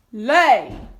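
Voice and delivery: loud booming giant order; giant command. Acted. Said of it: "said the giant".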